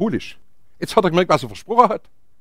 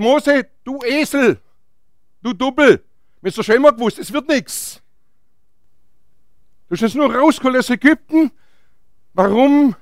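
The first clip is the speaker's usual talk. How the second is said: raised-pitch grumbling accusation; acted angry complaint; 4 semitones higher than usual. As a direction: play an indignant crowd blaming their leader. Acted complaint of the people to Moses. (gespielt empörtes Schimpfen)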